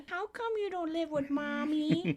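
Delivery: put-on squeaky cute little puppet kid; imitating a cute little Muppet voice. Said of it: "this cute little Muppet voice".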